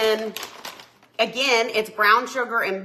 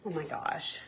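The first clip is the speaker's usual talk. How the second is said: normal talking voice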